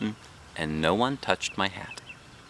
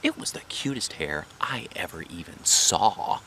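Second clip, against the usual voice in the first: plain reading voice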